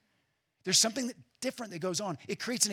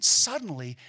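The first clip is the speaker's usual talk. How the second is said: punched suddenly; 9 dB louder than usual. Acted. Dramatic flood description.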